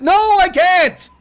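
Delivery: high, slow, drawn-out no I can't; mock high refusal. Mocking the friend's answer, silly high slow refusal. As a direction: stretch it out in a silly put-on voice.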